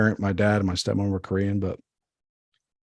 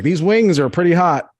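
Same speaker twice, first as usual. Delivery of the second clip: high, louder, deadpan fan voice; mock self at game; 8 semitones higher, 6 dB louder than usual. Himself at a soccer game, mock chirpy and high. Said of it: "I'm just like".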